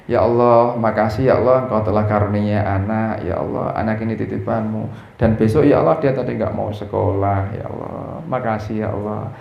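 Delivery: low, hushed thankful prayer, fading to a whisper; soft grateful prayer. Shown as a demonstration. Preacher shows the congregation his prayer, hushed and grateful. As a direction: pray gently, almost under the breath. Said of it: "Saya sambil begini".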